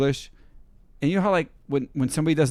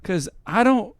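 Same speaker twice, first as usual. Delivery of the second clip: baffled plea; 6 semitones higher, 5 dB louder than usual. Retold or imitated. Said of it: "I was like".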